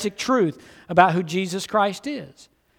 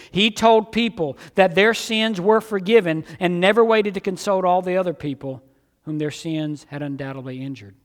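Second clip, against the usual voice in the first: ordinary delivery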